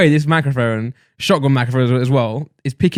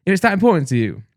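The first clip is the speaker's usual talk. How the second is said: rising, much higher exasperated rant; heated rant; 9 semitones higher than usual. Real, not acted. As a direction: build up the outrage. Ranting at absent lady.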